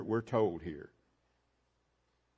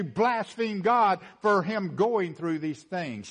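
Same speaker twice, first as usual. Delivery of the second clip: loud, higher preaching voice, slowing at the end; preaching at full force; 6 semitones higher, 4 dB louder than usual. Acted. Preacher to congregation, about the centurion: full-force preaching. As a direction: drive it home from the pulpit.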